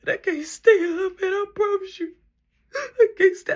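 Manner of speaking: high, loud mock sobbing plea; imitated crying. Brother sobbing to sibling about standing all night, mock crying. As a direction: wail it through tears.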